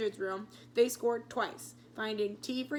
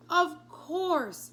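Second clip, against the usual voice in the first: sudden realization; 8 semitones higher, 6 dB louder than usual. A narrator's reading.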